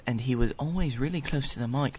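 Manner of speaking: put-on voice of a droning professor; mock academic, rattling along; professor impression. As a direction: run on like a lecturer too close to the mic. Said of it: "can I give you my rendition of the professor".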